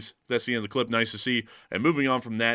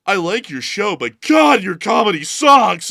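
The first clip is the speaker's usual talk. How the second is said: high, loud mock yell building to a shout; hammed-up heckler shout at the host; 9 semitones higher, 11 dB louder, slower than usual. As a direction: yell it like an angry viewer. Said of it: "Someone's about to come in and go".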